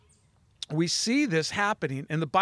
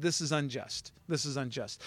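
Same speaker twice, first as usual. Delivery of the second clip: softly repeated quote, much softer; quiet quote; 3 semitones lower, 8 dB quieter than usual. Retold or imitated. Preacher quoting the boxer's complaint, softly repeated. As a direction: let the complaint drop low and soft. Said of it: "and she said".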